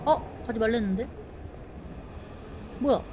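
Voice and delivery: normal talking voice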